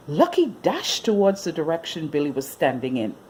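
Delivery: usual speaking voice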